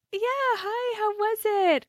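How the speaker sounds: retold gushing hello, way up high; high-pitched greeting. Girls answer the camper driving by, high-pitched greeting. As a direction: sing out a very high mock greeting.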